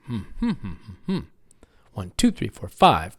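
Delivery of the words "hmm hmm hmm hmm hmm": soft hummed rhythm of a sentence; closed-mouth humming, syllable by syllable; hummed stress pattern. Shown as a demonstration. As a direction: hum the beats of the phrase quietly. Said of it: "everybody say".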